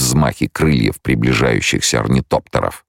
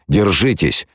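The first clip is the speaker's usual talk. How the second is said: higher-pitched call, mild in force; 6 semitones higher than usual. A narrator's reading. Paul to the blinded soldiers, calling out. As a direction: call out to steady the men. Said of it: "выкрикнул Пол".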